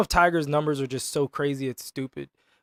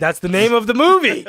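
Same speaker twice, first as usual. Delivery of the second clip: higher, louder mock-indignant defense; loud protest; 5 semitones higher, 6 dB louder than usual. Real, not acted. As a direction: protest your innocence over the teasing. Protesting a teased innuendo.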